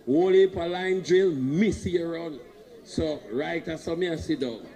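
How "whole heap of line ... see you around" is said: louder, higher, calling it out. Shown as a demonstration. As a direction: belt it out to the crowd. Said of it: "So you, you, you have to say something".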